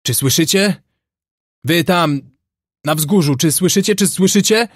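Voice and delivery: high, carrying hail; strong distant call. A narrator's reading. Unseen man hails the whites on the hill, calling strongly. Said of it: "rozległ się silny głos".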